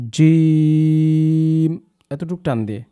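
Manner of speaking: জিম stretched slowly; drawn-out letter. Shown as a demonstration. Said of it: "তার থেকে একটু বেশি টান দিয়ে বলবো". (জিম বেশি টেনে)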